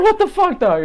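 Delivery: very high incredulous exclamation; retold shock. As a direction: act out stunned disbelief in a high voice. Acted shock, repeated to friend.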